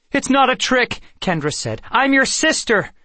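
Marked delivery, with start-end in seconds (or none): 0.1-1.0 high, loud urgent denial; desperate protest. A narrator's reading. Sister denying the captor's claim to her brother, desperate protest. 1.9-2.9 very high, loud plea; desperate insistence. A narrator's reading. Sister pleading with her memory-wiped brother, desperate insistence.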